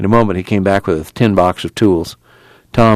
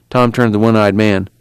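usual speaking voice

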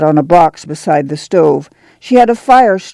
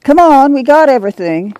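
calling out; 8 semitones higher than usual. Acted.

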